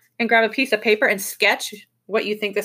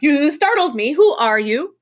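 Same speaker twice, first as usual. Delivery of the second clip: loud, startled creature cry; 7 semitones higher, 6 dB louder than usual. A narrator's reading. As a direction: blurt it out as if made to jump. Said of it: "The oink jumped".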